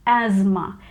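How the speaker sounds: asthma said as "AZ-ma", Z sounded; American pronunciation. Shown as a demonstration. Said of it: "We get this Z sound".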